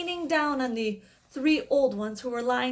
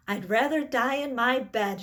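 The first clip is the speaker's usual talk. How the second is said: ordinary delivery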